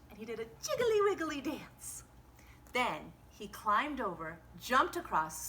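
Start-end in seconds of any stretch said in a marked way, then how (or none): 0.1-2.0 bouncy. A narrator's reading. Playful narration.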